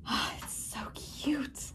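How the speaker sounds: whispering. Real, not acted. Cooing over plant.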